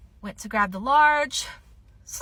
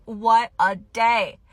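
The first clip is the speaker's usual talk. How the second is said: slow satisfied drawl; 5 dB louder, much slower than usual. Real, not acted. Drawn-out satisfied sigh.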